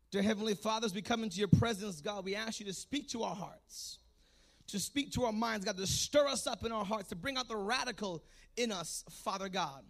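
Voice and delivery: hushed, reverent appeal to God; prayer. Real, not acted. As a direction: pray quietly over the congregation. Leads a prayer.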